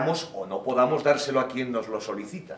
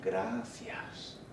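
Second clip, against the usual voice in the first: "Gracias" drawn out, very soft, both syllables lingered on; savored slowly; 3 semitones lower, 13 dB quieter than usual. Shown as a demonstration. Speaker savoring the word's two syllables, slow and hushed. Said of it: "saboreo lentamente sus dos sílabas".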